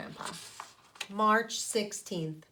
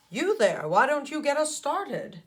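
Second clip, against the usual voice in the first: louder, brisk teacher calling on a pupil; 5 semitones higher, 5 dB louder than usual. Acted. Teacher asking the old skin to lead the pledge. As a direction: call on the class like a teacher. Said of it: "she says".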